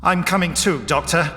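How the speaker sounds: firm insistence. A narrator's reading. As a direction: state it with determination. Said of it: "said Harry firmly".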